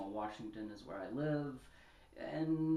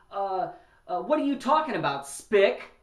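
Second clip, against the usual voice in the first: high, much louder mocking jeer; hypothetical slur yelled; 7 semitones higher, 14 dB louder than usual. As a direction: bark it out like a bigot at the bar. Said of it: "if he would have turned around and said".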